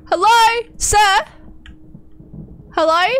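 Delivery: loud high call to a stranger; calling out to a figure in the dark, loud. Real, not acted. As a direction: shout out to the man with the axe.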